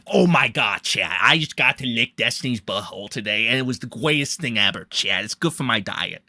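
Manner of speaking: lightly voiced streamer impression; mock someone confiding excitedly to their chat; Nick Diorio. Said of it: "where Nick Diorio's just like".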